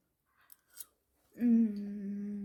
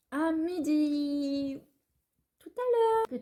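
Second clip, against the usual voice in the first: sing-song goodbye; 5 semitones higher, 5 dB louder, much slower than usual. Real, not acted. Sign-off stretched and sung up.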